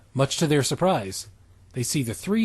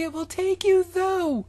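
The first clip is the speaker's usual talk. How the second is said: high falsetto schoolgirl; girl voice. Acted. Podcast host voicing Stephanie as a high falsetto girl. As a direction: squeak it out in a silly girly falsetto.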